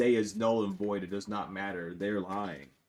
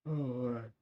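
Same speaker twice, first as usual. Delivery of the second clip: said through a yawn; 7 dB quieter than usual. Real, not acted. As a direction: let a yawn stretch the words. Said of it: "Look at that yawning".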